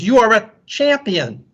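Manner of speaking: high-pitched call of praise; mild scream. A narrator's reading. Father calling to the mountains, mild scream. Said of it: "the man screams".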